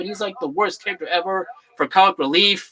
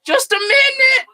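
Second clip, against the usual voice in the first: Jar Jar voice; 10 semitones higher, 4 dB louder than usual. Retold or imitated. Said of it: "He's like".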